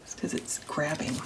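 hushed whisper. Real, not acted. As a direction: whisper it while concentrating. Whispering while working.

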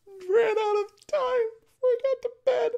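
loud, very high-pitched squeal; panicked wail. Real, not acted. Player out of time before bed, panicked wail. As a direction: wail it out at the top of the register.

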